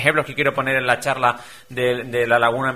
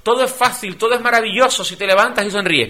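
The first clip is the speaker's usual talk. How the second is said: higher, mock bright positivity guru; overly upbeat optimist; 7 semitones higher, 3 dB louder than usual. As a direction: parody a relentlessly cheerful coach. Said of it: "gente demasiado proactiva". (imitación exagerada de optimista)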